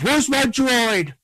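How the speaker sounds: slow, high, loud goofy mock voice; mocking Poe. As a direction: lampoon a carefree hero. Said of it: "He goes".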